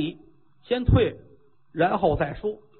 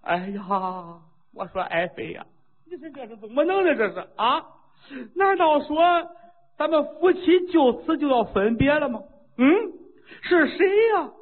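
weeping lament; 9 semitones higher than usual. Acted. King at his unconscious queen's side, tearful lament building to a wail. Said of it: "宣王这眼泪哗就下来了".

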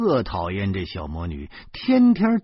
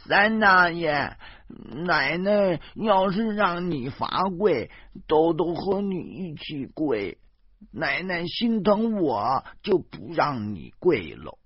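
put-on high, slow earnest little boy; a small child promising loyalty; 小男孩认真地说; 7 semitones higher, slower than usual. Little nephew offers to kneel too.

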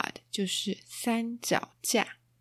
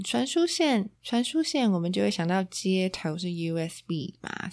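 ordinary talk

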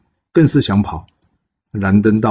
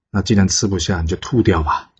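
usual speaking voice

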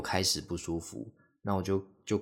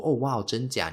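higher, louder mock surprise of mom; mom's surprise; 5 semitones higher, 5 dB louder than usual. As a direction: play mom amazed at the news. 模仿妈妈惊讶. (模仿妈妈惊讶，音调更高更响)

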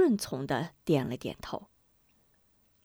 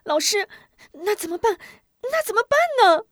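high, loud, fast panicked plea; anxious child on the verge of tears; 13 semitones higher, 7 dB louder than usual. A narrator's reading. Boy begging the teacher to save him, panicked. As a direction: beg the teacher, almost crying. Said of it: "急得要哭".

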